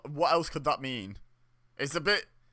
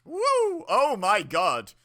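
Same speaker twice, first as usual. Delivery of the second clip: excited; 8 semitones higher, 8 dB louder, much slower than usual. Real, not acted. Big bump in the match.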